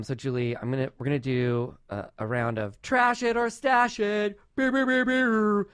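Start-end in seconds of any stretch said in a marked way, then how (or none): 2.8-4.4 high, drawn-out segment announcement; game-show announcer. Pretend. Host launching the podcast's game round, mock announcer. 4.6-5.7 high, loud vocal beeping; improvised game-show music in beeps; mock jingle beeps. Host faking music for a game segment, high beeps. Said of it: "not theme song for that, but music".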